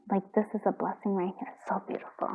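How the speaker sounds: whispered in quiet awe; hushed whisper. Real, not acted. Reader awed by the cards, whispering to viewers. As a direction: breathe it out as a whisper.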